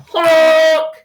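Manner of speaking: loud, drawn-out honk; yelled truck horn. A narrator's reading. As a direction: blare it like a big truck's horn. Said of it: "yelled a dump truck coming through".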